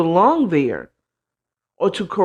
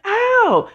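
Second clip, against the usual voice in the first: mock yelp of pain; 12 semitones higher than usual. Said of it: "And it's like".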